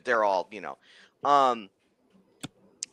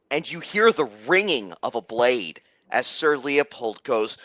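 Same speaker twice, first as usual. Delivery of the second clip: normal talking voice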